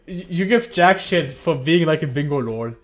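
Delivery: loud, laughing playful rant at a friend; hammed-up outrage, half laughing; mock anger. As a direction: pretend to be pissed at Bubs. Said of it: "now I'm kinda mad".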